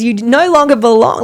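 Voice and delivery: loud, high command casting something out; forceful retold prayer. Woman in ministry casting out mold, loud forceful command.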